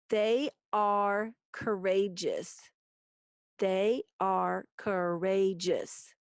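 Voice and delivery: slow, measured, every syllable given room. Shown as a demonstration. Teacher reading the haiku's last line, slow syllable count. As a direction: sound it out slowly for counting. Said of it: "five syllables".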